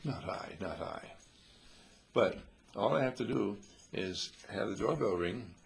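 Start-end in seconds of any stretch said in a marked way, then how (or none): 0.0-1.0 mild mock superiority, a little lower and softer; a hint of haughty denial; superior attitude. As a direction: lightly play a smug refusal. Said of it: "you in your superior attitude".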